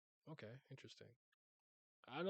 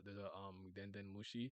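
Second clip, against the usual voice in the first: normal talking voice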